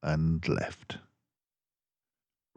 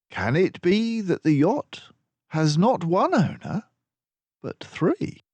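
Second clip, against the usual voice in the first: higher, lively teasing gentleman; Dawson's voice; 12 semitones higher, 3 dB louder than usual. A narrator's reading. Dawson teasing Basil about his smug smile, lively raised voice. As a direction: tease your friend, amused and quick.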